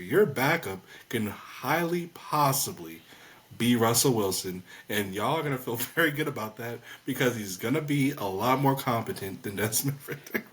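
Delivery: ordinary talk, nothing marked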